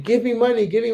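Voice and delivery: high pleading kid voice, imitated; begging kid. Street kid pressing a tourist for money, high pleading. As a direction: mimic a pushy little beggar.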